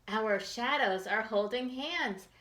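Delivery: plain reading voice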